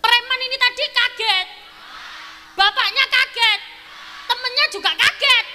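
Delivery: rising excited surprise; building surprise. Shown as a demonstration. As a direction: climb the shock step by step. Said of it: "saya bilang kaget".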